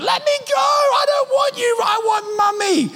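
put-on very high, slow whiny toddler yell; imitating a protesting toddler; child screaming. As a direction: wail like a boy being carried off. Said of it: "as he protests and screams".

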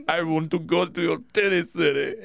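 low-voiced put-on suitor accent; Ernesto. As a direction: keep up the mock foreign accent, hammed up. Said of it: "That's better".

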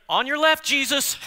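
high, loud shout in passing; playful passing yell; calling out. Acted. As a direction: call it out like a runner overtaking someone. Runner calls out while passing Jesus.

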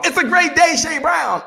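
high, bright-voiced hype greeting; booming cheerful phone hello. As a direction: answer like it's the best day ever. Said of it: "I always answer the phone".

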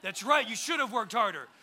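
loud mock told-you-so; imagined scolding parent. Dad to child who missed the reward, mock scolding. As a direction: act out a dad rubbing it in. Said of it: "didn't need to hear me go".

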